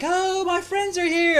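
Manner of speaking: high, drawn-out excited squeal; excited retold cry. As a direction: imitate a friend thrilled at arrivals. Acting out friend's excited cry.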